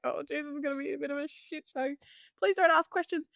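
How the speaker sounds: high, fast inner panic; pleading under her breath then rising; retold dread. Buyer dreading agents' questions, high anxious mutter. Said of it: "I was just like".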